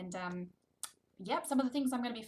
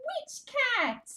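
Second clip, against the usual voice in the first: high loud gasp then name; dramatic reveal; 6 dB louder than usual. Shown as a demonstration. Reading to toddler, hammed-up excited reveal. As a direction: announce it with delight.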